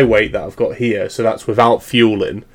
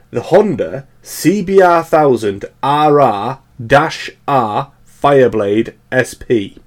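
drawn-out model name; slower than usual. Real, not acted. Spelling out absurdly long model name.

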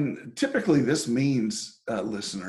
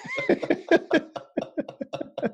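high laugh. Real, not acted. Laughing after the joke.

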